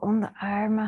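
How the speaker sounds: ordinary talk, nothing marked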